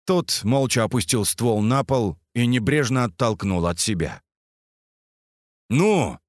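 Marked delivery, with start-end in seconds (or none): 5.7-6.2 raised voice. Acted. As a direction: press him with a raised voice. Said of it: "повысил голос Прометей".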